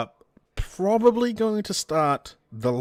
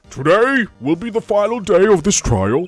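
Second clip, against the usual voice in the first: put-on higher, louder old judge; 4 semitones higher, 5 dB louder than usual. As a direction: speak as a booming old judge. Voicing the judge.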